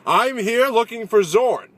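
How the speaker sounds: high, loud, slow proclamation; Norse traveler. Retold or imitated. Norse traveler insisting on his search to the villager, loud mock proclamation. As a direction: bellow it grandly and stretch it out. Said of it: "And he goes".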